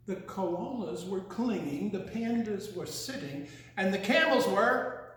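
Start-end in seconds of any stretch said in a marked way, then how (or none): none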